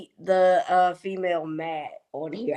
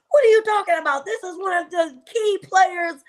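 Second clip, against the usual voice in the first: put-on incredulous boyfriend; 10 semitones higher, 3 dB louder than usual. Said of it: "And he's like".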